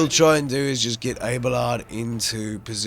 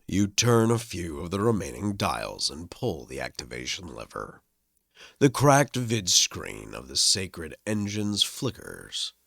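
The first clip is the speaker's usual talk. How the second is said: game text narration; 5 semitones lower, 3 dB quieter than usual. Acted. Reads game text aloud.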